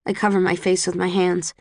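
usual speaking voice

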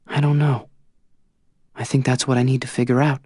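a hint of Tris, hesitant; Tris voiced lightly.

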